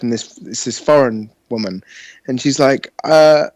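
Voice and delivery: usual speaking voice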